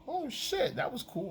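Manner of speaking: high and soft, impressed; mock clueless boyfriend. Imitating the boyfriend praising his girlfriend, high and soft. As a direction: coo it like a lazy guy. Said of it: "And you go".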